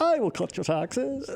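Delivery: high, put-on Irish accent voice. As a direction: do her in a mock Irish lilt. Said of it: "I don't know how to do an Irish accent".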